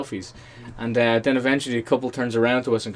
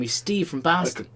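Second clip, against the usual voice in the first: higher-pitched imitated Boston accent; 6 semitones higher than usual. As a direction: put on a Boston accent for the name. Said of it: "that's the accent I love".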